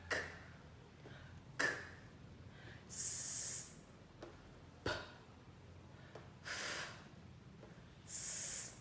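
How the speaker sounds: "k, s, p, f" as pure air, no voice; voiceless sounds. Shown as a demonstration. Teacher giving examples of voiceless sounds, "k, s, p, f" only breath. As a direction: isolate "k", "s", "p", "f" as unvoiced puffs with gaps. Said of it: "không tạo ra tiếng".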